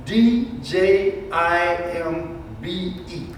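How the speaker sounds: slow letter-by-letter spelling; spelled out. Shown as a demonstration. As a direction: spell it out slowly, each letter on its own. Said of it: "you'll see it spelled".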